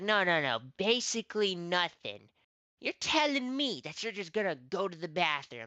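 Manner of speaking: retold angry coach. Coach correcting him angrily.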